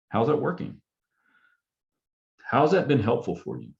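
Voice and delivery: genuinely curious, non-expert asking. Shown as a demonstration. Counselor modeling a question for a struggling parent, curious. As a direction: ask like you truly want to know. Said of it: "You have to have a very curious, um, kind of non-expert".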